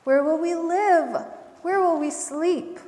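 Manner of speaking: storybook worried children, lightly acted; crying out. A narrator's reading. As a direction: plead with Papa like worried little ones. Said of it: "cried the young possums".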